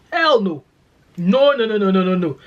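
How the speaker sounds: loud, high, insistent no; laughing off the idea, loudly; emphatic loud denial. Real, not acted. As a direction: refuse it with full force. Emphatic denial about weather.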